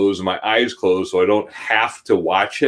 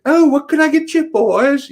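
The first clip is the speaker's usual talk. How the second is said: much higher put-on voice; comic skit character; 5 dB louder than usual. Retelling the comedian's [name] bit, in a high sing-song character voice. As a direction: chirp it like a fussy mother figure from the skit. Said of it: "whatever his skit".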